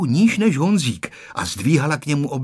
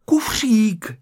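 shouting; 11 semitones higher, 5 dB louder, much slower than usual. A narrator's reading. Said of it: "křičela maminka na nástupišti".

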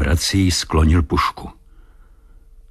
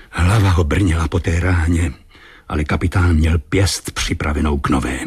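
plain reading voice

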